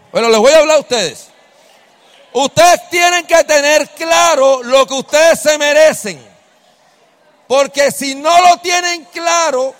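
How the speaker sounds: loud, high exhortation to the crowd; loud preacher urging the singles; preaching at full force. Acted. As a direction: drive the point home, fired up. Preaches loudly to the audience.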